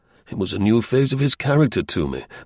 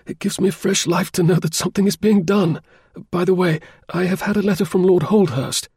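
high-pitched voice of an anxious invalid client; eager client; 9 semitones higher than usual. A narrator's reading. Client telling Holmes of fresh life and a letter, high eager voice. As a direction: pour out news, high and grateful.

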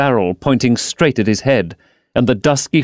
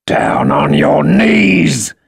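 slow growled command; 4 semitones higher, 3 dB louder, much slower than usual. A narrator's reading. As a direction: growl the order slowly. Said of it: "growled the man".

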